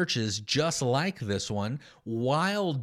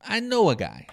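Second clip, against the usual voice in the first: "I know a guy" repeated higher, with a knowing nudge; higher sarcastic repeat; 6 semitones higher, 3 dB louder than usual. Retold or imitated. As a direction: lift it as a wink to the crowd. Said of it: "[name] is being sarcastic".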